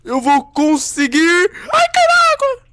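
high, slow drawn-out cry; excited yelling. Real, not acted. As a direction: push through it, then yelp. Frantic race moment.

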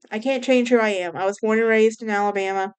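normal talking voice

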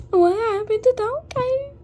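high, loud, slow shocked question. Real, not acted. Gamer shocked by what happened to Donkey, high and slow. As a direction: react in disbelief at what you see.

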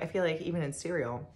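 normal talking voice

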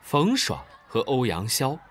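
plain reading voice

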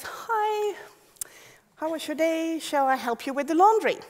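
chirpy helpful robot greeting; bright eager robot, high and airy. Retold or imitated.

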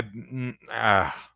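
ordinary talk

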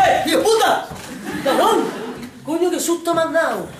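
high, loud startled yell; startled swearing. Acted. Pranked friend startled by a scare, loud curse.

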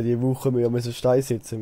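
usual speaking voice

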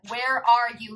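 ordinary talk, nothing marked

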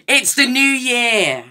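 high, slow joyful shout; excited shout. Real, not acted. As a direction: cheer it at the top of your voice. Countdown hits midnight.

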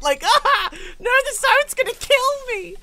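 high, loud mock freak-out; mock panic. Gamer mimicking teammate's freak-out over the zone, mock panic. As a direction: imitate a panicked player wailing, slow and high. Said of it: "And you're freaking out".

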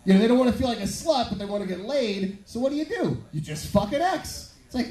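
usual speaking voice

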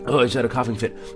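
normal talking voice

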